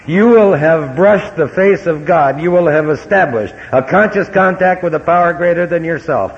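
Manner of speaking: loud, high preaching at full force. Acted. AA speaker preaching the promises to a big crowd, loud and soaring. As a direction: lift the room like a revival preacher.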